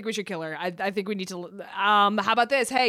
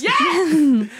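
high, loud burst of joy; triumphant yell; 6 semitones higher, 7 dB louder than usual. Real, not acted. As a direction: shout the win. Cheer after the successful punch.

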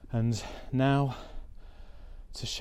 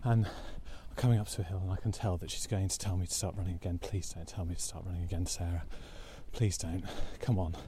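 out of breath; 3 semitones lower, 6 dB quieter, faster than usual. Real, not acted. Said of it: "I can't talk".

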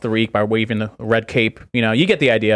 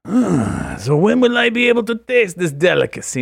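lightly higher eager Watto; a hint of Watto's high greedy eagerness; 6 semitones higher than usual. Asking the waiter when he can taste it, as Watto. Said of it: "put on the Watto voice".

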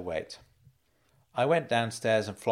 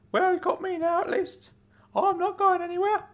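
much higher put-on uncle voice; Uncle Claude, reassuring; 3 dB louder than usual. Uncle promises nephew he's staying, high and reassuring. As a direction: reassure him in a high character voice.

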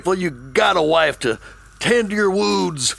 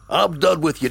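usual speaking voice

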